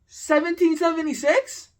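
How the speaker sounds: high, loud disbelieving repeat; incredulous echo. Real, not acted. Venting to viewers about a classmate, a loud, high disbelieving echo. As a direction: throw his answer back in disbelief. Echoing the kid's absurd answer.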